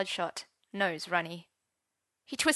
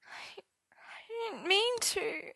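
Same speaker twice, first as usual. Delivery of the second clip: slow, high whispery sob; tearful stammer; 13 semitones higher, 5 dB quieter, much slower than usual. A narrator's reading. Arthur caught out and crying to Merlin, tearful whimper. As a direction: whimper it out, soft and faltering.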